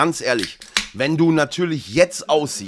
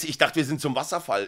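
ordinary talk

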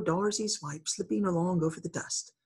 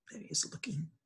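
whisper; 2 semitones lower, 13 dB quieter, faster than usual. Real, not acted. Aside while reading the story.

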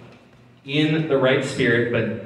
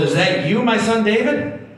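higher, rising questioning call; 9 semitones higher than usual. A narrator's reading. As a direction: narrator voicing a call to someone far away. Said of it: "He calls it".

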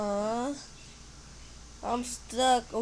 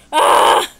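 sudden loud breathy scream; startled scream; 21 dB louder than usual. Real, not acted. Player crashing in the game, startled scream.

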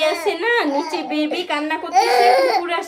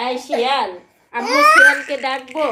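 loud threat half laughing; mock threat; 2 semitones lower, 9 dB louder than usual. Real, not acted. Mother scaring toddler with the jackal, loud mock threat.

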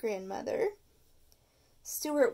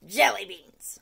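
muffled voice; 3 semitones lower, 8 dB louder than usual. Acted. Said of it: "said a muffled voice".

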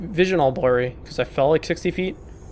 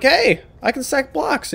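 dopey block-stacking dude; 8 semitones higher, slower than usual. Retold or imitated. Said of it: "who's like".

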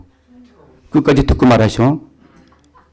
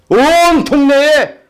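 dramatic call; 12 semitones higher, 5 dB louder, much slower than usual. Retold or imitated. Dramatic announcement of rumor.

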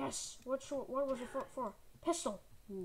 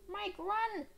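high, urgent yelling; frantic warning shout; 8 semitones higher, 4 dB louder than usual. Pretend. Player sending Mike inside away from infected, frantic shout.

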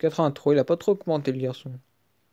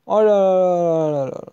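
delighted oh là là; 4 dB louder than usual. Real, not acted. Reacting to Kanté pull.

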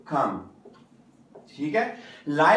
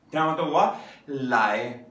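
ordinary talk, nothing marked

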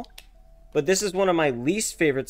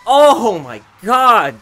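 stunned shout; 6 semitones higher, 11 dB louder, much slower than usual. Real, not acted. Knockout in Wii boxing.

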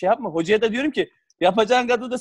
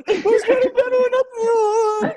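high, wailing mock cry through laughter; hammed-up sobbing; 4 dB louder, much slower than usual. Crying player tells the club president he isn't being played, mock sobbing. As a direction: whimper it like a crying kid, half laughing. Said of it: "Böyle ağlıyorum".

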